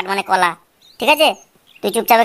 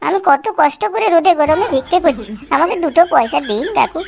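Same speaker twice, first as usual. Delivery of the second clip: whining beggar; 3 semitones higher than usual. Acted. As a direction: wheedle coins from passers-by. Whining beggar recording from box.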